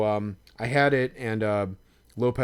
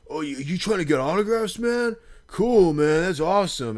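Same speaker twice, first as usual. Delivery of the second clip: high, loud, sneering fake praise of a stranger; mock the autograph hunter with fake cheer; sarcastic mock enthusiasm; 8 semitones higher, 6 dB louder than usual. Drunk fan mocks autograph hunter, high sarcastic cheer. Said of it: "sarcastic and pejorative".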